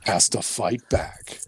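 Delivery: low, slow movie trailer announcer; deep, dramatic trailer narration with weighty pauses. Retold or imitated. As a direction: intone it like an action trailer voice-over. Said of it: "It's like the movie voice".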